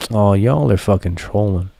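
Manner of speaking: usual speaking voice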